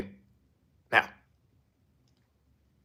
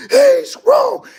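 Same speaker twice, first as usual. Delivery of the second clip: high, squeaky mock of the critics; 10 dB louder than usual. A crowd of critics doubting him, high mock voice. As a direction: lightly mimic the doubters. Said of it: "folks saying".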